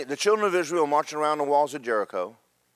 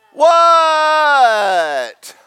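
loud, high drawn-out yell; shocked yell; 10 semitones higher, 10 dB louder than usual. Retold or imitated. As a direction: stretch it out in astonished disbelief. Disciples react stunned to Jesus.

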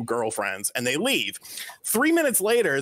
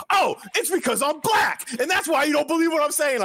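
high-pitched outraged yell; imitated screaming; 12 semitones higher, 3 dB louder than usual. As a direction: imitate a buddy screaming in protest. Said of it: "he just starts screaming".

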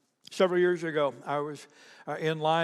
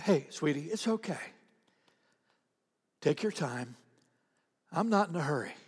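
soft, unhurried comforting, with pauses; gentle reassurance; 5 dB quieter than usual. Retold or imitated. Customer comforts the trembling checkout gal, softly reassuring. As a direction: soothe someone upset, keeping the voice down.

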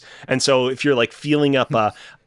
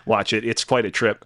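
usual speaking voice

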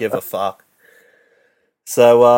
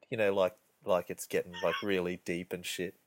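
ordinary delivery

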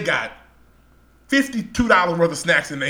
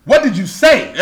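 loud, higher, challenging demand; confronting demand; 7 semitones higher, 7 dB louder than usual. Retold or imitated. Confronting the clerk over the slur, loud challenge. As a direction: call him out hard. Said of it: "I'm talking just like this".

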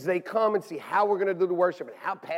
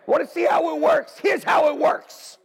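louder, slowing on "Here's how it works"; mock announcement shout; 7 semitones higher, 4 dB louder than usual. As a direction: proclaim it like a challenge. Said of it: "I'm gonna say".

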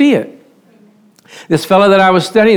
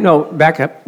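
normal talking voice

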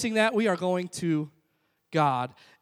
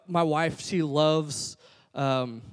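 ordinary delivery

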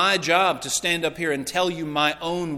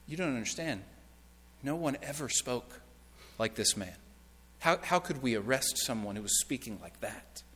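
much softer, lower, halting reply; hushed awe; 4 semitones lower, 10 dB quieter than usual. Acted. Men back from Jesus answering the Pharisees, hushed awe. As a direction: answer in a low, awestruck hush.

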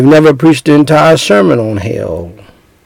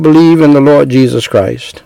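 ordinary talk, nothing marked

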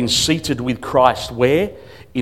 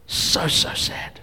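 sad whisper; 7 dB quieter, much slower than usual. Real, not acted. Whispered lament.

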